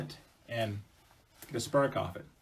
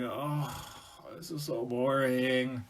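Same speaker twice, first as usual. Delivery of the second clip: drawn-out bored groaning; sighing, fed-up boredom; 4 dB quieter, much slower than usual. Acted. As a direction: whine slowly with boredom. Bored wife left waiting alone.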